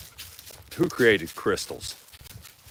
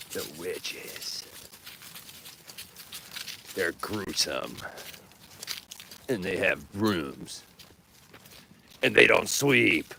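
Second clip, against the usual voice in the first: slow, drawn-out spooky witch tale; mock spooky storyteller; 3 dB quieter, slower than usual. Telling Bobby about gruesome witches, mock spooky. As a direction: draw it out like a campfire scare.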